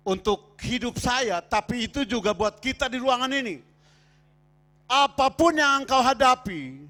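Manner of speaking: khotbah lantang dengan nada terangkat; khotbah tegas. Real, not acted. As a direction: tekankan tiap frasa dengan suara keras. Berkhotbah tegas kepada jemaat. (berkhotbah tegas dan lantang)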